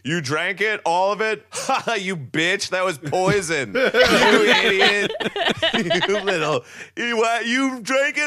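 high, gleeful frat bro taunt; cackling bro mocking the pledge. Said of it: "like Rush at a fraternity".

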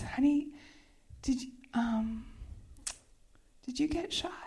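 halting, whispery question; retold hushed concern. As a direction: ask very softly, with long hesitations. Retold hushed question after the gunshot.

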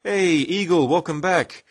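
high, loud character greeting; put-on character voice. Host talking Gab to Eagle, high put-on voice. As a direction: call it out in a high comic voice. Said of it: "I can talk Gab".

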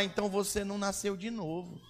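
ordinary delivery

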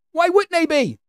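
put-on high-pitched, disbelieving echo of the kid; mocking high-voiced retort; incredulous mock repeat. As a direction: throw it back way up high. Mocking repeat of the kid's reply.

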